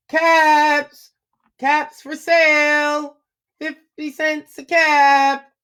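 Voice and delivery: high, slow peddler's call; calling out wares. Acted. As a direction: sing out the wares to the street. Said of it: "he called".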